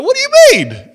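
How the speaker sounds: very high, loud mock-shocked cry; indignant outburst. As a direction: squeal it in hammed-up protest. Acts indignant reaction to accusation.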